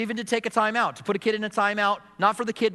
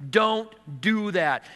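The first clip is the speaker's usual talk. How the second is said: slow, deliberate warning, each word spaced; slow firm warning; much slower than usual. Acted. God warning the sinner at the roadblock, slow and firm. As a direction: draw out the warning slowly.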